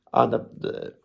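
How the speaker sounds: normal talking voice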